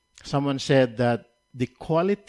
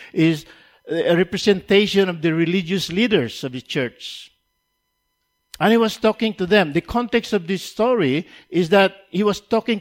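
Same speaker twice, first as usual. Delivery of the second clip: loud, raised pulpit voice driving the point; rising sermon fervor; full-force preaching; 7 semitones higher, 7 dB louder than usual. Acted. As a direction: preach it hard and high to the congregation.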